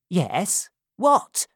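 desperate to hear the end; cried out. A narrator's reading. Missus Corney crying out to dying Sally, urgent. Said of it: "cried Missus Corney".

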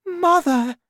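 very high, loud cry; high-pitched shout; horrified cry. A narrator's reading. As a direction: cry out aghast as the daughter. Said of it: "Missus Cranky cried".

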